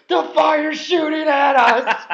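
high, loud, slow panicked yell through laughter; laughing impression of a panicked coworker; Nard Dog panicking. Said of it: "Nard Dog's like".

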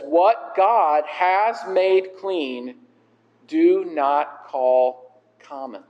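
very slow, weighted words; slow, measured scripture quote; solemn pronouncement. Acted. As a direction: pronounce it slowly, word by word, as a divine decree.

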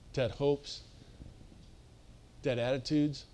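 soft, low and sober; grave, quiet appeal with long pauses; hushed seriousness. Real, not acted. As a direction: drop right down and let each phrase land. Preacher turns hushed and serious.

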